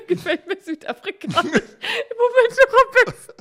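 high, slow laughing words; giggling helplessly through it. Real, not acted.

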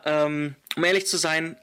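ordinary talk, nothing marked

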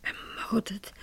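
soft whispered echo of the word, stunned; disbelieving whisper. Acted. Madame repeats the director's news of murder, disbelieving whisper. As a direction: breathe the word back in disbelief.

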